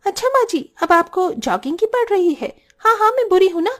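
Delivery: taunting. Acted. Daughter-in-law taunts mother-in-law.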